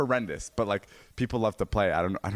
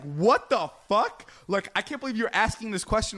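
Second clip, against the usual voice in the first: stunned disbelief; 12 semitones higher than usual. Retold or imitated. Retelling his shock at the girl's question, stunned and high. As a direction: replay the shock of hearing that question. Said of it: "and it's just like".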